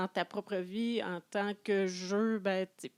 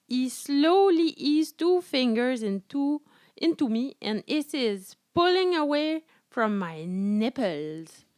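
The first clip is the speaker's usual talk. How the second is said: slow, louder, breathy-style dramatic read; sultry reading; 3 semitones higher, 6 dB louder than usual. Acted. Reading a steamy novel aloud to listeners, hammed-up sultry. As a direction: lean into the steamy prose, slowly.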